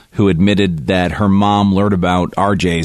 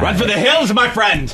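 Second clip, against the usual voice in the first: high-pitched, joking cry to flee; mock alarm warning; 12 semitones higher than usual. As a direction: send them running in a high comic voice. Jokey warning to absent listener.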